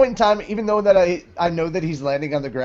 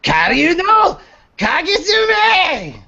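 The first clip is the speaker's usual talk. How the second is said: higher, slow, drawn-out attack cry; anime attack shout; 5 dB louder, slower than usual. Acted. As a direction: yell the spell name like an anime hero. Natsu shouts attack name.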